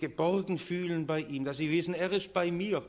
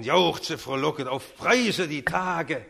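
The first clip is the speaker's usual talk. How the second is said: put-on loud, heavily accented jubilation; jubilant choral exclamation; 6 dB louder, slower than usual. Preacher recalling the Christmas Oratorio's choirs and drums, jubilant. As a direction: proclaim it like a chorus over drum beats. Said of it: "schweren Schlägen der Pauken".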